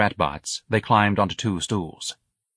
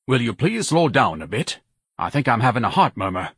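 somewhat raised, aggrieved voice; pompous complaining son; 4 semitones higher than usual. Acted. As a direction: complain grandly to mother.

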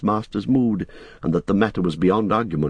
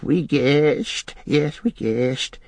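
high creature half-whisper; Gollum whispering; 6 semitones higher, much slower than usual. Acted. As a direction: whisper it as a creature, drawing out the end. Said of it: "he whispered".

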